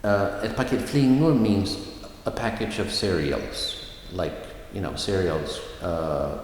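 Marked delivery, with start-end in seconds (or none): none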